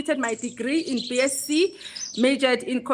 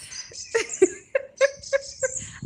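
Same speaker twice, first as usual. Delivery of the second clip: high, breathy laughter; disbelieving laugh; 12 semitones higher, 4 dB quieter than usual. Real, not acted. Laughing at undressed people at water park.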